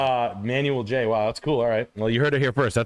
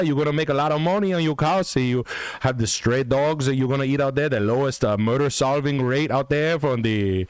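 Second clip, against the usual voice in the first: higher, hammed-up Puerto Rican accented English; mock Puerto Rican realtor; 6 semitones higher than usual. Pitching a Puerto Rico house to listeners, hammed-up Puerto Rican accent. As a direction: sell the house like a brash Puerto Rican agent.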